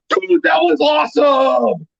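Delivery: high, drawn-out mock shout; retold excited cheer. Employees to customer who stood up for them, elated shout. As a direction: cheer it out slowly as the thrilled staff.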